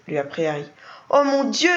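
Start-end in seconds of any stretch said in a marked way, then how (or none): 1.1-1.7 high startled cry, louder; aghast exclamation; shocked cry. A narrator's reading. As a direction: gasp it out in sudden shock. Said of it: "s'écria Mrs Plunkett".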